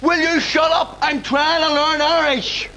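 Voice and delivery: fed-up father shouting at son; exasperated shout. Acted. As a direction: snap at the interrupting son. Dad snaps at son.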